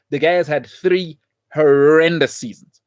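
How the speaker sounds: slowed, "horrendous" stretched; slow weighted "horrendous"; stressing horrendous. Real, not acted. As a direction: drag out "horrendous" in scorn. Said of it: "three horrible seasons".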